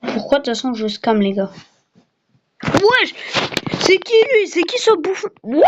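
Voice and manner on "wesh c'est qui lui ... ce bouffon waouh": high startled yell; surprised shout. Real, not acted. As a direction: shout out in shock. Startled by enemy player.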